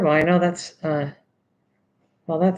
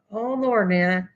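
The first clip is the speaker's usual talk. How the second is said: ordinary delivery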